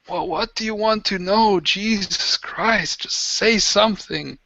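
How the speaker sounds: high, weary desperate prisoner; pleading captive. Acted. Beaten prisoner urging interrogators to ask, high whine. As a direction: beg them in a high tired whine.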